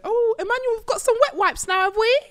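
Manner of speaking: high, bright call-out to Emmanuel; teasing call to a friend. Real, not acted. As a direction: sing it across the room. Calling out teasingly.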